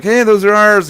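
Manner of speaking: calling out. Retold or imitated. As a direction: shout after him to stop. Confronting a guy taking stuffed animals.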